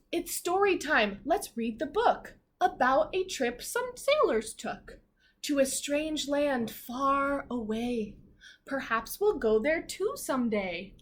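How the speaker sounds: slow, sing-song reading of the rhyme; read-aloud to children. Acted. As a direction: read it slowly to a class of little kids. Reading picture book aloud.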